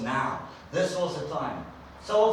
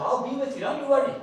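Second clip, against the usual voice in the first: reassuring God; 10 semitones higher, 5 dB louder than usual. Retold or imitated.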